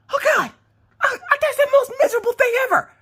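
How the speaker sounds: high-pitched, hammed-up wail; squealing, overdone misery; miserable wailing. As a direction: whine it out in mock agony. Acted misery when the swab ends.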